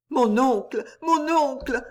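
put-on a frightened girl pleading, climbing in pitch; anguished plea. A narrator's reading. Niece pleading with her uncle at the grave, anguished. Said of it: "murmura la jeune fille palpitante d'angoisse".

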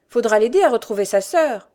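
loud, eager reminder; insistent son. A narrator's reading. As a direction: plead keenly for the girl. Son adds a plea.

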